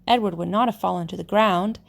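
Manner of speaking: ordinary delivery, nothing marked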